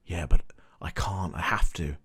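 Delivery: low, hushed, earnest hero; grave, quiet imitation of an honourable man; solemn honest Jon. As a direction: sink low into a near-whisper of reluctance. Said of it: "He goes all, like, Honest Abe".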